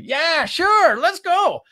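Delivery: high cheerful put-on voice for Joe; easygoing coworker. Old coworker Joe invited to coffee, high cheerful yes. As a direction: play the happy old coworker saying yes. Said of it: "What's Joe gonna probably say?".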